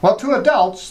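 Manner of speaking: ordinary delivery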